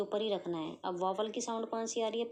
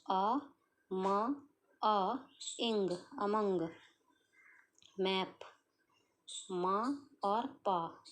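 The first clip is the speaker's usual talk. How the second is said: आ, मा, इंग drawn out slowly, then among; teacher sounding out among; much slower than usual. Shown as a demonstration. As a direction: spell it out by sound and blend. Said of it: "लेकिन पढ़ते वक्त".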